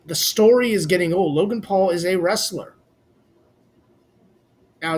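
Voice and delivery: ordinary talk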